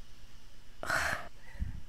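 long airy sigh of disapproval; weary sigh. Acted. Nun sighing over the girls, weary sigh. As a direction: sigh before the sermon starts.

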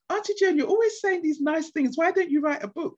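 high imitation of eager children pleading; lightly voiced kids urging her; children's voices. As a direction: pitch it way up like the kids asking. Children in Kenya quoted.

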